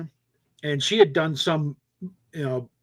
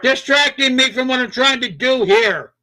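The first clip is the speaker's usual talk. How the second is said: slow, louder and higher, annoyed; irritated scolding; 8 semitones higher, 6 dB louder than usual. Real, not acted. Snapping at the person distracting him, slow and loud. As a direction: tell them off, spacing each word out.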